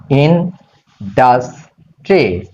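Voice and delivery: ইন্ডাস্ট্রি slowly as ইন, ডাস, ট্রি; syllable by syllable. Shown as a demonstration. Teacher showing students the spelling, ইন্ডাস্ট্রি slowly in syllables. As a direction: split ইন্ডাস্ট্রি into three spaced syllables. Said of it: "syllable করে দিচ্ছি।".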